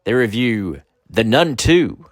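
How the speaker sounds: loud, big show-intro announcer. Acted. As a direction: announce it like a TV promo.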